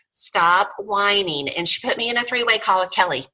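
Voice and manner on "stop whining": very slow, drawn out stop whining; slow stern order. Retold or imitated. Lisa to Jan, drawn-out mock scolding. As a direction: drag it out like a scolding. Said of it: "she goes".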